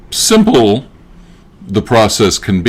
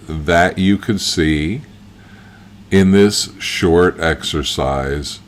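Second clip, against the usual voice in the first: guided visualization. Acted. Guide leading listeners into past-life imagining, calm guided visualization. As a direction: ease them gently inward, leaving space between phrases.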